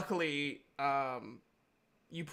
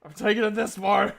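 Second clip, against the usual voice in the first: defiant shout; 6 semitones higher, 9 dB louder than usual. Acted. Injured character refuses healing.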